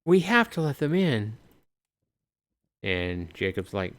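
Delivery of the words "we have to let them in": high exasperated woman's voice; insistent woman. Acted. Woman urging Jacob to let the child in, high insistent voice. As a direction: push Jacob to open up, high. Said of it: "And she's like".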